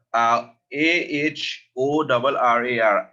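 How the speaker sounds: letters A H O R R A R spelled slowly one by one; spelling it out. Shown as a demonstration. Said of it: "Ahorrar means to save".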